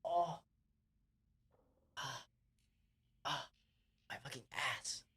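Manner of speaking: soft high groans of pain; quiet pained moaning. Real, not acted. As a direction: whimper through a cramp. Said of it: "Oh, my ass".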